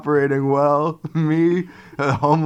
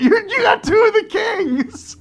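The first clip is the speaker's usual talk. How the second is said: excited disbelief. Retold or imitated. Excited reaction in retold story.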